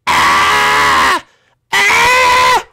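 loud, harsh monster cry; creature scream. Pretend. Strange creature in the fire greeting visitors, screaming. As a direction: scream like a startled cartoon beast.

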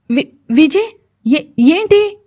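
high, stammering, startled question; taken aback. A narrator's reading. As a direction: stumble on the first sounds, pitch climbing in alarm. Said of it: "బీరిపోయి చూసింది స్వప్నిక". (బీరిపోయి, తడబడుతూ)

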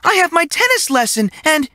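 put-on girl refusing the teacher's Saturday plan; girl's refusal. A narrator's reading. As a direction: protest like a spoiled girl. Girl excuses herself.

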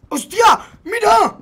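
high-pitched excited exclamation; real startled excitement; amazed. Real, not acted. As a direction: point it out in astonishment. Amazed by gameplay.

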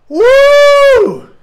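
high, loud cheering whoop; ecstatic whoop. Real, not acted. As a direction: let out a huge joyful whoop. Celebrating big donation.

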